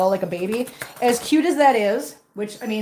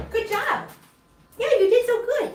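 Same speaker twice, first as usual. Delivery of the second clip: overwhelmed by cuteness; 12 semitones higher than usual. Real, not acted. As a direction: coo praise at the kitten. Praising a kitten after feeding.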